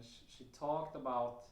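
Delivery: normal talking voice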